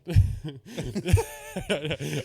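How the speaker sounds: high, loud laughing fit; real laughter. Real, not acted. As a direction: burst out laughing at your own joke. Laughing at own joke.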